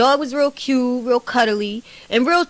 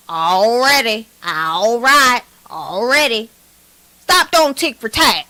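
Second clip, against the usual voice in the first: louder mock Oklahoma drawl of "Already, all right, already"; mock Oklahoma accent; 3 dB louder, slower than usual. Mocking TKO's Oklahoma talk for viewers, Oklahoma accent. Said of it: "that's how they talk".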